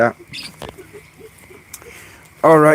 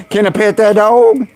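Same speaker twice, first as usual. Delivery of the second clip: high, drawn-out put-on voice; hammed-up slow, high plea; imitated catchphrase; 9 semitones higher, 4 dB louder than usual. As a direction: stretch it out high like a silly catchphrase.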